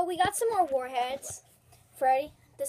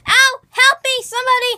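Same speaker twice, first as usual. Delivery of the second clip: high, loud cry for help; pained cry for help; 11 semitones higher, 13 dB louder than usual. Real, not acted. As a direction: wail for help as the sourness hits. Sour candy pain.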